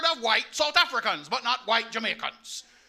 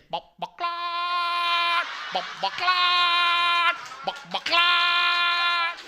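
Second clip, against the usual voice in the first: chicken imitation; 7 semitones higher, much slower than usual. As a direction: cluck like a yard chicken. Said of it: "What them saying?".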